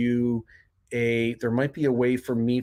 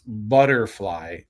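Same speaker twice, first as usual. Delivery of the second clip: drawn-out American "butterfly"; American accent; 3 semitones lower, slower than usual. Shown as a demonstration. Host contrasting with British accents, American accent. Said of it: "if you're from America".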